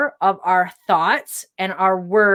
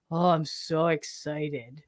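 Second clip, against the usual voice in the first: lower, much softer and slow, sarcastic flatness; unexcited excited; 6 semitones lower, 12 dB quieter, slower than usual. Shown as a demonstration. Mocking someone who claims excitement without feeling it, deflated and soft. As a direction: say you're excited with zero excitement. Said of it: "it's the tonality as well".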